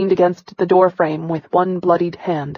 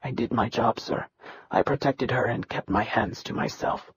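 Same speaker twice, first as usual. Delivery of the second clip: low, much softer plea; hushed plea; 7 semitones lower, 10 dB quieter than usual. Acted. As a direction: keep your voice down and plead. Boy pleads before the caning.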